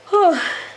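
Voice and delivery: high, huffed sigh. Real, not acted. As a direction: huff out a voiced sigh. Sighs while playing.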